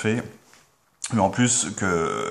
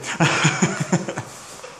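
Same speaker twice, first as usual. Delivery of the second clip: breathy laugh; 8 semitones higher than usual. Real, not acted. Laughs after jumping in.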